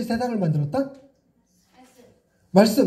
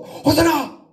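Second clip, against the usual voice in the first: scolding tone; 7 semitones higher than usual. Shown as a demonstration. Preacher jokingly scolding a boy named Hoseon. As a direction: bark the blessing like a mock telling-off. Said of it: "그 야단치는 톤으로".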